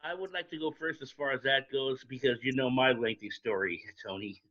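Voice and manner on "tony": ordinary delivery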